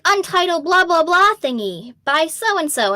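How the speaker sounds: playful imitation of a vague listing; mock title announcement. Mimics vague anime project titles.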